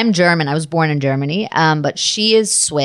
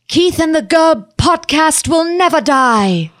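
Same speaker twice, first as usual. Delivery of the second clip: high, slow Swiss-accented heroine proclamation; Mercy; 8 semitones higher, slower than usual. Shown as a demonstration. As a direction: draw it out like a triumphant battle cry. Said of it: "Can you, can you say Keith".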